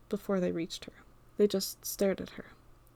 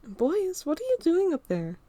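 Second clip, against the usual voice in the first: mother calling out; 9 semitones higher, 3 dB louder, slower than usual. Acted. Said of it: "Evelyn calls from her room".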